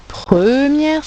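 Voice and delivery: première stretched out on its first syllable; stress on première. Shown as a demonstration. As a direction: lean long and hard on première. Teacher contrasts first and last syllable.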